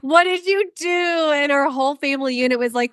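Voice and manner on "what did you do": high, slow, stretched-out astonishment; comically appalled, pitched way up; mock disbelief. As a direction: imitate an aghast partner, drawing out each word. Husband's shocked reaction retold.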